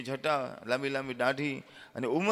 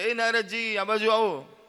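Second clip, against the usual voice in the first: loud, higher call across the forest; 5 semitones higher, 6 dB louder, slower than usual. Acted. Villager calls Narad over from afar, loud call. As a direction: shout to Narad from where he sits. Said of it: "બૂમ પાડે છે કે".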